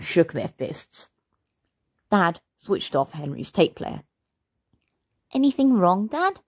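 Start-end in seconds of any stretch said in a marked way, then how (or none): 5.3-6.4 high, sugary innocence; sweetest voice. A narrator's reading. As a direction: ask it like an innocent angel. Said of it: "asked Henry in his sweetest voice".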